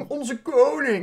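a touch of mock reverence; mock reverent. Mocking the king to the viewers, a touch of mock reverence. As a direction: sneer the title with fake awe. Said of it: "Dat is niet mijn koning".

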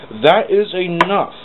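slow, loud and high stern command; booming. Acted. Sir Topham stopping the arguing engines, booming slowly. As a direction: boom it out slowly. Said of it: "he boomed".

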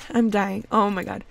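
ordinary talk, nothing marked

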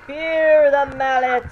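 hammy villain threat, drawn out; slow booming villain boast. Pretend. Gamer taunting goons as a mock villain, slow and loud.

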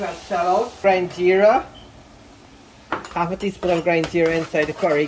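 normal talking voice

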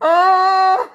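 high, loud wailing laugh; real helpless laughing fit; uncontrolled laughter. Real, not acted.

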